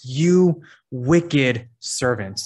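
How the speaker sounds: very slow "You wicked servant", every word weighted; emphasizing each word. Shown as a demonstration. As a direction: draw out "You", then hit "wicked" and set "servant" apart. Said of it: "emphasizing these words".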